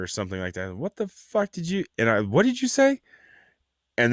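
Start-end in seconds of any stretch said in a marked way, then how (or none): 0.8-1.8 high-pitched incredulous challenge; retold indignation. Challenging a stranger in the parking lot, high indignant disbelief. Retorting to passing stranger's insult. 2.2-3.0 very high, incredulous challenge; retold indignation. Confronting the passerby over his insult, high mock-incredulous challenge. Retorting to passing stranger's insult.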